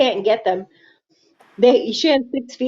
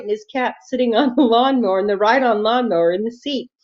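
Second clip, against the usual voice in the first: normal talking voice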